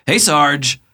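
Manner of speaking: loud high shout across the room; calling out loud. Acted. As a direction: yell "Hey, Sarge!" to get attention. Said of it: "And he goes".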